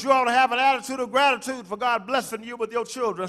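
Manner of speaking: ordinary talk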